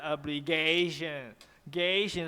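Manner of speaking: mock a learner's rising tone on "obligation"; wrong rising stress. Shown as a demonstration. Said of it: "they always pronounce like they're raising tone".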